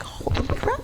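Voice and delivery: high breathless whisper; stunned whisper. Retold or imitated. Reacting to the boss's summons, whispered shock.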